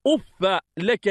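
أف said "uffa", short a after doubled f; fatha on fa. Shown as a demonstration. Teacher showing students أف as "uffa", fa with fatha. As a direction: say أف with an open "-fa" ending. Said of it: "بفتح الفاء".

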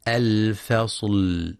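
الفصل with a thin fa and a lam held halfway, very slow; light lam. Shown as a demonstration. Teacher models the correct reading, lam held gently. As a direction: stretch the lam of الفصل, not cut short. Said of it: "وينتبه لصفة البينية".

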